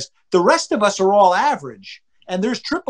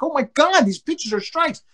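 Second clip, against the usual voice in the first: retold exasperation; 7 semitones higher than usual. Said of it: "you're gonna have umpires where you're like".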